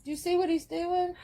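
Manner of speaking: very high, cooing question; high gushing pet talk. Real, not acted. Owner pointing out the jealous pet, high cooing.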